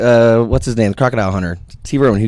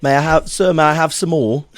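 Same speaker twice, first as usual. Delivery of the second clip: higher mock Cockney orphan; Oliver Twist; 5 semitones higher than usual. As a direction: plead politely in a put-on British accent. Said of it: "Oliver, Oliver Twist, like, Cockney".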